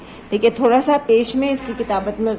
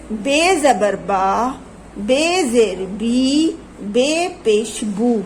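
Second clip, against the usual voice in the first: put-on slow, each syllable drawn out for children; slow syllable drill; much slower than usual. Shown as a demonstration. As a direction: spell it out slowly so kids can follow. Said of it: "کس طرح کی آوازیں نکل رہی ہیں".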